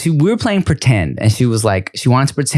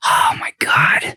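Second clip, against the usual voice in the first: slow, breath-only mock despair; exasperated whisper; slower than usual. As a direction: whisper it slowly, as if at wit's end. Retold exasperation at daughter.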